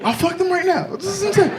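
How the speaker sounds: high, hammed-up drunk search for anyone; high giddy drunk voice; drunk desperation. Drunk self hunting at bar.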